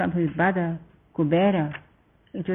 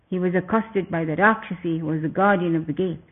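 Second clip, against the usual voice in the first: plain reading voice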